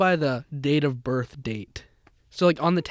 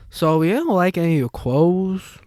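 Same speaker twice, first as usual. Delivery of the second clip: put-on shop girl; 4 dB louder than usual. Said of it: "she's like".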